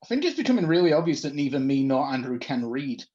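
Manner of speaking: ordinary talk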